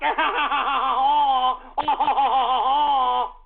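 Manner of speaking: long, loud laugh; real laugh. Real, not acted. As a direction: laugh hard at your own joke. Laughs at his own impression.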